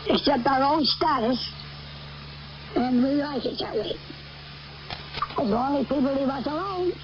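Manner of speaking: ordinary talk, nothing marked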